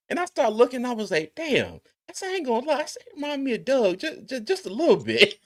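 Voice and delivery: high, breathless giggly telling; laughing while confessing the joke. Real, not acted. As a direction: squeak it through held-back laughter. High squeaky retelling while laughing.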